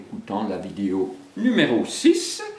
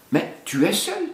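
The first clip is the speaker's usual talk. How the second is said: higher, astonished question; surprised; 11 semitones higher than usual. Acted. Bagheera finds Baloo alone, surprised. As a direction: take it as a shock. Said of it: "s'étonna-t-elle".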